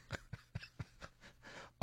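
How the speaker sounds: real laugh breaking into the phrase. Real, not acted. Host amused at discussing writing with a prize winner, laughing. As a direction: chuckle midway through the introduction. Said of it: "I'm laughing because".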